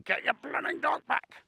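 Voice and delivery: imitating the angry keeper. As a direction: squeak it out like a furious gamekeeper. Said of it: "the keeper's standing next to me going".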